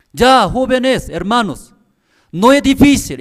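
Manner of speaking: high, loud imitation of the pastor's insistence; mock pastor teaching. Imitating the pastor repeating to the seminarians, loud and high. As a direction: hammer it home like the old pastor.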